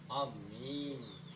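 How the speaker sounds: slow, stretched अमीन with long m; drawn out amin. Shown as a demonstration. Teacher correcting students, slowly stretching अमीन. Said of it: "अमीन नहीं भाई".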